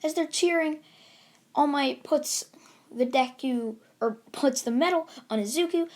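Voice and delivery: usual speaking voice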